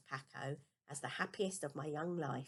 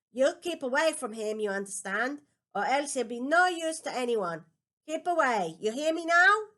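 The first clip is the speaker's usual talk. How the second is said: loud, high, sharp command from a scolding father; stern angry father; 7 semitones higher, 12 dB louder than usual. Acted. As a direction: bark it at the boy across the lunch table. Father pointing knife, scolding son.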